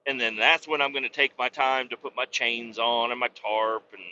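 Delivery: ordinary talk, nothing marked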